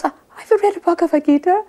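high, loud mock objection; acted protest. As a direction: protest as the man being scolded. Scolded man protests to guru.

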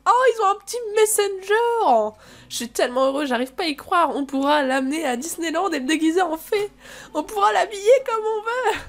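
high, squealing delight; gushing excitement. Acted. Thrilled friend texting about the coming baby, gushing.

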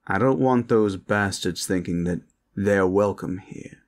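usual speaking voice

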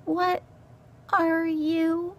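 high, halting little voice; terrified mouse. Acted. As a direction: ask haltingly, frozen with fear.

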